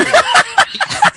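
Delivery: a sudden high cackle; high burst of laughter. Real, not acted. Reader cracking up over the cloth.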